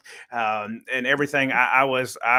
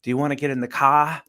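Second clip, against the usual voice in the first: light mock Boston accent; put-on Boston voice with a broad "cah"; 3 dB louder, faster than usual. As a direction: imitate a Bostonian, dropping the r in "car". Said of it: "somebody in Boston and they're like".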